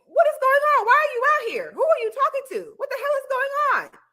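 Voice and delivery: high-pitched indignant boyfriend impression; mock outrage. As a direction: fire off exasperated questions, hammed-up. Retold boyfriend demanding answers.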